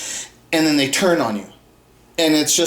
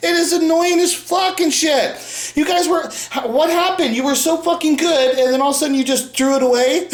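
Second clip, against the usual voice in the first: exasperated rant; 9 semitones higher than usual. Real, not acted. Ranting at Arsenio Hall.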